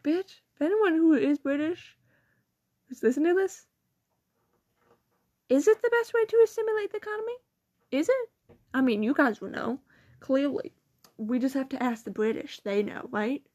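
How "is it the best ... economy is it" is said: high, fast mock question; incredulous challenge. Real, not acted. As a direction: challenge the listeners, pitched up. Mock asking British listeners.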